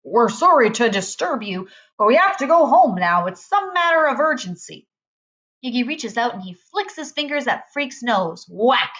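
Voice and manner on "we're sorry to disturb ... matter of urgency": boy's put-on grown-up formality; trying to sound grown-up. A narrator's reading. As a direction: put on a stiff, important grown-up voice. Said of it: "make his voice sound deep and more grown up".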